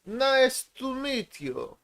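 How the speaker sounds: high, hammed-up made-up foreign accent in broken English; goofy made-up accent. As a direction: clown around in a silly foreign accent to make his wife laugh. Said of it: "I don't know what accent it is".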